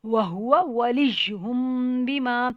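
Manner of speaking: very slow melodic tajweed chant; slow Quran recitation. Shown as a demonstration. Teacher reciting the verse slowly for the class. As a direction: hum the ghunna, slow and sung.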